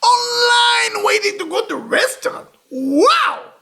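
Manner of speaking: high, loud amazed exclaim; amazed shout. Real, not acted. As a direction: cry it out in wonder. Amazed repeat of crowd.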